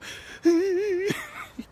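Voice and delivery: high-pitched fake wail; hammed-up sobbing; mock crying. Thug overcome with love, mock crying. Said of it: "I can't stop crying".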